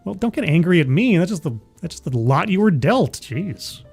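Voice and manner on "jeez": normal talking voice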